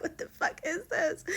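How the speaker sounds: high, laughing disbelief; retold appalled squeak; mortified. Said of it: "we were all pretty mortified".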